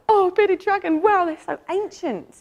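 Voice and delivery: meeting a bearded dragon, high eager wonder; excited. Retold or imitated. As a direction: gasp with delight at a first reptile. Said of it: "And I was so excited".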